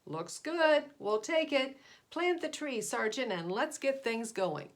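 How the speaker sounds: loud, high, bossy alien chief; pollution chief. Acted. Chief of the Pollutions orders the sergeant, loud and bossy. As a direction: bark out the orders like a pushy boss. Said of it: "and finally he said".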